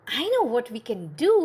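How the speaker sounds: fast, eager announcement; wife excited with a plan; wife's eager idea. Acted. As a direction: blurt out the idea.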